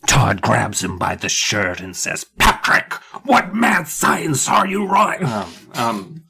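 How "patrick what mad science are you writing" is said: high, drawn-out theatrical cry; hammed-up confrontation, stretching the words; melodramatic demand. Todd grabs Patrick by the shirt, melodramatic demand. Dramatic acted grab-and-confront line from the book.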